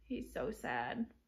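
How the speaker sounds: plain reading voice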